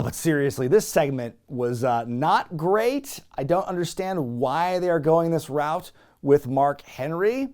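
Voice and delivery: ordinary talk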